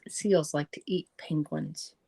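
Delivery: ordinary delivery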